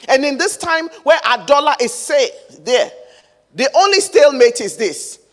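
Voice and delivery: loud, driving sermon delivery; impassioned preaching. Real, not acted. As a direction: preach it out with force to the congregation. Preaches with rising intensity.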